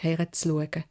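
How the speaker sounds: ordinary delivery, nothing marked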